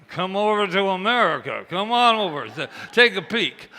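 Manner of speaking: loud, high and laughing, come on over; excited invitation. Retold or imitated. To the Italian woman, an excited laughing invitation. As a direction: beckon her eagerly with a laugh.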